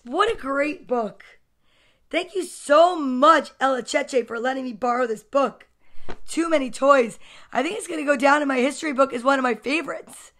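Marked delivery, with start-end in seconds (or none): none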